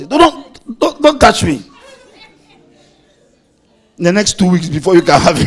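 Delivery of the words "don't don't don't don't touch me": loud, higher don't touch me; a pastor mimicking a bride refusing her husband's touch; mock prim wife. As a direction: play the fussy wife pushing him off. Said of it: "It's like".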